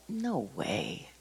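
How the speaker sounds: low, very soft refusal; quick quiet dismissal. Retold or imitated. Refusing God's nudge to make amends, quietly dismissive. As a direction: dismiss it under your voice. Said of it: "Just like that. Just dismissed it".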